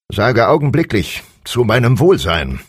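high, loud royal command; snapping king. A narrator's reading. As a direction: bark the order at him. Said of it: "fuhr ihn der König an". (herrischer König, der anfährt)

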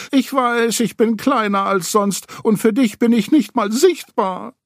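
high, hurt little scold; scolding Piglet. A narrator's reading. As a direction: tell him off in a small wounded voice. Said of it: "schimpfte Ferkel".

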